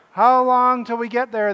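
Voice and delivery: put-on loud, high whine of bored kids in the car; whining kids. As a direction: kids nagging dad from the back seat, high and loud. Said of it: "they would say".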